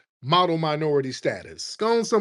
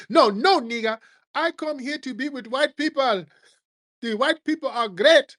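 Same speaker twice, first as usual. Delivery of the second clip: high, dismissive put-on voice, much higher; mock immigrant voice; 11 semitones higher than usual. Mocking immigrant brushing off the warning in a high put-on voice. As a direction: sneer it in a squeaky mock voice.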